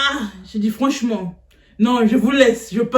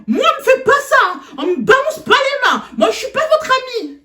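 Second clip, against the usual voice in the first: much higher, laughing mock scolding; mock stern teacher; 11 semitones higher than usual. Teacher scolds the student for gesturing, high and mocking. Teacher grabs her shoulder.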